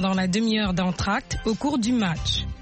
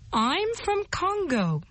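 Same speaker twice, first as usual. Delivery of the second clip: slow, raised-pitch teaching model; model to repeat; 9 semitones higher, slower than usual. Shown as a demonstration. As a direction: draw the sentence out slowly for learners. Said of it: "Et répétez".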